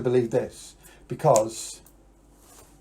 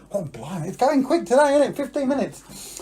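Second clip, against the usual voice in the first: surprised; 10 semitones higher than usual. Real, not acted. Surprise at the time.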